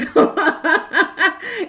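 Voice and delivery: high laughing burst; real incredulous laughter; amused laugh. Real, not acted. Laughs at imagined insult.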